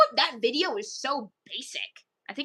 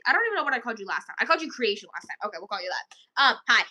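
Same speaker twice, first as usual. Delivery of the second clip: ordinary delivery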